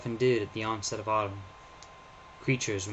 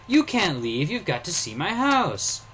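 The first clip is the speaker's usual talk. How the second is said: put-on insistent child; 8 semitones higher, 5 dB louder, slower than usual. Boy pleads with stranger.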